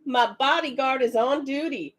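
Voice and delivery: ordinary delivery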